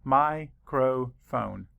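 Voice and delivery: Microphone said slowly, beat by beat, mi-cro-phone; syllables split. Shown as a demonstration. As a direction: stretch Microphone into slow clear syllables. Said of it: "Count the syllables".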